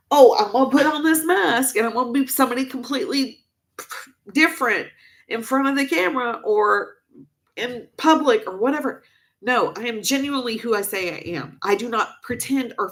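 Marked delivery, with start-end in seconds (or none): 0.1-9.0 lightly mocking, higher and quicker; a hint of a sneering imitation of people who doubt her; mocking the accusers. Imitating critics who call her fake, higher and quicker. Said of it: "and tell you".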